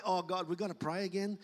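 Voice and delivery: soft, imitated reluctance at a chore; mock reluctant groan. Preacher imitates someone under pressure to pray, mock reluctant. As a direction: say it quietly, as if pressured into it. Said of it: "under pressure to pray".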